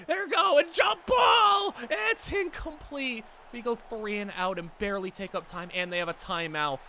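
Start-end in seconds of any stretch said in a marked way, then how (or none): 0.1-3.2 tense shout. Real, not acted. Tense jump ball.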